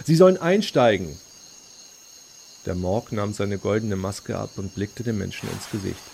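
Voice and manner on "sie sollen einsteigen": high, loud impatient order; pressing impatience. A narrator's reading. Willis ordering passengers aboard, impatient. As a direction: push them to get moving. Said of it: "drängelte Willis".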